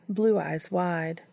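ordinary delivery

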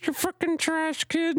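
put-on very high, slow little kid voice; tiny squeaky kid voice. Gamer mocking a kid's tiny voice, high and squeaky. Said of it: "the smallest little tiny voice".

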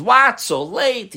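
indignant, high, loud exclamation; mad. Retold or imitated. Angry hotel guest hears how late the driver prays, indignant. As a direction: burst out in mock outrage. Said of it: "He gets mad at him".

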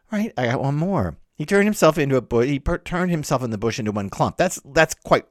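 ordinary talk

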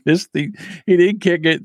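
usual speaking voice

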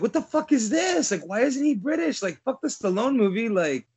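high-pitched mock outrage, imitating upset fans; indignant fans. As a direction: play the incredulous fan, pitch jumping up. Voicing outraged British fans.

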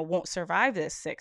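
ordinary talk, nothing marked

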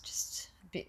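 soft breathy whisper. Real, not acted. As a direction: let it fade to a whisper. Trails off into a whisper.

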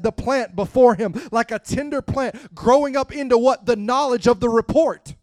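loud, climbing sermon crescendo; preaching at full force. Acted. Preacher pressing the congregation, rising to a loud fervent cry.

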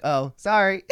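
high, loud pretend sorry; mock apology. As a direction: toss out an insincere apology. Retold mock apology to annoyed girl.

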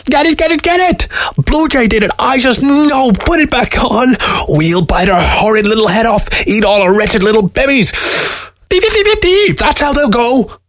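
frantic, very high creature voice; shrieking little weasel out for revenge; high-pitched screeching weasel. A narrator's reading. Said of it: "screeched a high-pitched voice".

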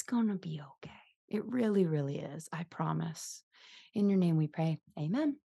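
soft, gentle prayer voice; heartfelt prayer spoken gently. Acted. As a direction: pray softly over the listeners. Leads a prayer.

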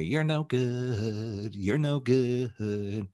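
drawn-out, hollow taunt; slow gremlin taunt. Pretend. Coach voicing the listener's gremlin, slow mocking taunt. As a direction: drag each word like a nagging inner critic. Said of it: "Is your gremlin playing out full time and telling you".

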